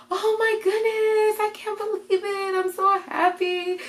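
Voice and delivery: very high, drawn-out joyful disbelief; overjoyed. Retold or imitated. Dad learns he'll be grandpa, overjoyed and high-pitched. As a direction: imitate Dad overwhelmed with happiness.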